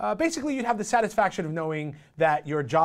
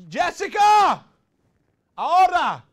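calling out loud; 11 semitones higher, 8 dB louder, slower than usual. Acted. Calling out to employee.

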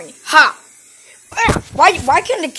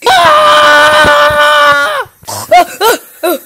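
very high, loud shriek; piercing horror scream; terrified scream; 10 semitones higher, 6 dB louder, much slower than usual. Acted. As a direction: scream for your life. Screaming from nightmare.

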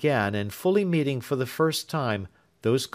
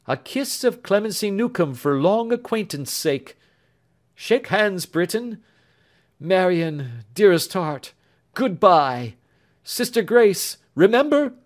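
put-on raised, tender young lover's voice; lightly voiced earnest young man; 9 semitones higher, 6 dB louder than usual. Young man tells Marian of Grace, tender and higher. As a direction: speak fondly of the absent sister. Clemency and Alfred farewells.

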